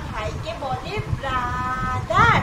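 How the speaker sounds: very slow, low teaching voice; slow corrective dictation. Shown as a demonstration. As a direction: spell it out slowly for the child. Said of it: "এটা বলে না". (ধীরে ধীরে শিখিয়ে বলা)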